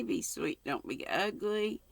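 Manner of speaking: plain reading voice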